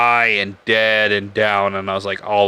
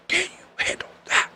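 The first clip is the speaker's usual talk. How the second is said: high, breathy whispered snarl; freaking-out psycho quote; 7 dB quieter than usual. Retold or imitated. Retelling the movie scene, whispered and high. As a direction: hiss it out like a man losing it on camera. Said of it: "he's, like, freaking out and he's like".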